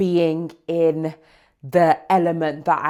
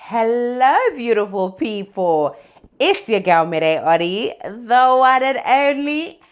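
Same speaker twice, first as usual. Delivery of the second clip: big bright host greeting, loud and high; excited; 8 semitones higher, 8 dB louder than usual. Real, not acted. Vlogger greeting her viewers on her return, excited and loud.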